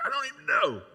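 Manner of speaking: high, loud mock outburst; retold cry of grumpy exasperation; exasperated retort. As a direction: blurt it out in exaggerated frustration. Retelling snappy answer to friend's question.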